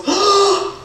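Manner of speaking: sharp high mock gasp of fright; startled gasp. Comic re-enactment of the startled lady's gasp. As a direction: gasp like a scared lady on the train. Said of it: "and she goes".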